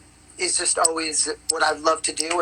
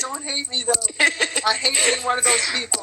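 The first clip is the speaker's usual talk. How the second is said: apologetic pleading; 6 semitones higher than usual. Retold or imitated. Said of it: "I'm like".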